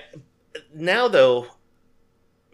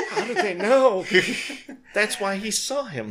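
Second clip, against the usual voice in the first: mock amazement; 7 semitones higher than usual. Mock amazed movie reaction.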